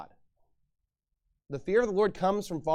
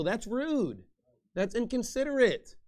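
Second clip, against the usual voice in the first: ordinary talk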